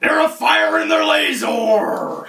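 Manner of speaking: high, slow, booming mock alarm; hammed-up shout. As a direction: yell it like a cheesy B-movie hero. Mocking the show's zombie robots.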